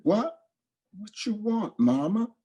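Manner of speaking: put-on high, eager little child; child's voice. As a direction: answer like a trusting small kid. Child answers mother.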